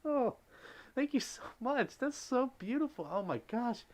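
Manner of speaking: high, breathless thank you; high, moved thanks to a generous donor; overwhelmed thanks. Real, not acted. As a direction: thank them as if near tears.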